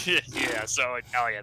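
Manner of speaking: usual speaking voice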